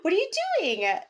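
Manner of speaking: high, loud, gushing greeting with a laugh; excited girl. Retold or imitated. Girl running to greet him, high and gushing. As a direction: bubble over with delight. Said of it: "she's like".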